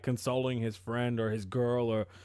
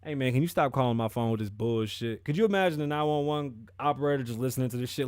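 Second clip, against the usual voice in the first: normal talking voice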